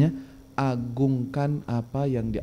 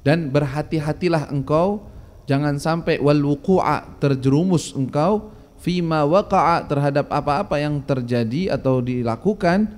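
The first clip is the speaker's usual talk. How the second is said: ordinary delivery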